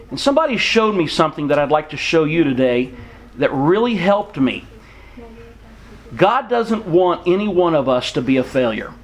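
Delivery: ordinary delivery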